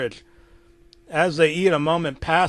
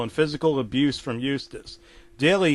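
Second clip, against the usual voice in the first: plain reading voice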